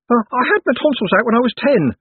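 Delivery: high, loud little-man voice; timid Owlswick. A narrator's reading. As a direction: pipe up helpfully in a high nervous voice. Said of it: "said Owlswick".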